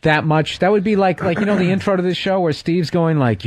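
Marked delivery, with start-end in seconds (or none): none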